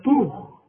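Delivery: طوخ with a thick emphatic ṭa; heavy ṭa. Shown as a demonstration. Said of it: "زي ما تقول". (طوخ بطاء مفخمة)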